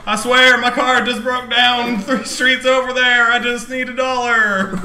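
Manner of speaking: laughing beggar asking for a dollar; high, loud pleading hustler; panhandler voice. Pretend. Begging stranger bit, laughing.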